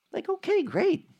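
high, sarcastic okay, great; mock unimpressed. A listener brushing off the virgin birth, sarcastic and high. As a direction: shrug it off with mock boredom.

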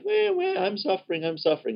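high, whiny self-pitying wail; whiny mock lament. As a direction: whine like a man feeling sorry for himself. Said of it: "David's crying".

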